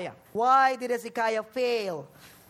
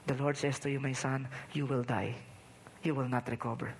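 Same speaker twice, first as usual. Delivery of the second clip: much softer and lower, gently; gentle; 8 semitones lower, 6 dB quieter than usual. Acted. The Lord telling his son Hezekiah he will die, softly. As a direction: break hard news tenderly to a son.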